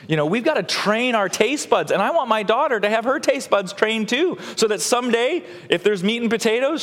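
high, impassioned pulpit delivery; fervent preaching. Real, not acted. Preacher urging the congregation to train their taste buds, fervent. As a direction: preach it with mounting enthusiasm.

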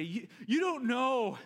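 loud, high, desperate husband begging; self-centred begging on the phone; pleading ransom call. Pretend. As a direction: plead for your wife back, all about yourself. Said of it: "I speak to them and I say".

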